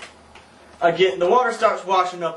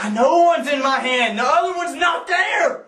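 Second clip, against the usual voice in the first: exasperated yell; 8 semitones higher than usual. Retold or imitated. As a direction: shout it like she just isn't getting it. Arguing with girl about sandal.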